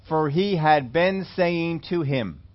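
"For he had been saying to him" read very slowly, each word weighted; slow, stressed reading. Shown as a demonstration. Preacher explaining the Greek tense, slow and deliberate. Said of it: "In the Greek, it is the progressive imperfect".

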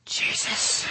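breathy slow whisper; awed whisper. Acted. Blind Bartimaeus realises Jesus is near, whispered. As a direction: breathe his name in wonder.

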